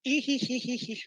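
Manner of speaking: high, soft teasing giggle after a joke; cheeky giggle. Acted. Teacher teasing the class after his joke, cheeky high giggle. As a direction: giggle at your own tease. Teacher jokes about solving.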